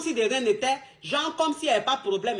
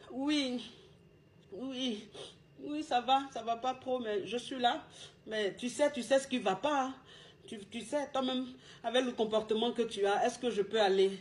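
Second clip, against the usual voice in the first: sad, mopey answer; 7 dB quieter than usual. Shown as a demonstration. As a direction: mumble it like you've been crying. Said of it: "il faut qu'ils sentent que tu es triste".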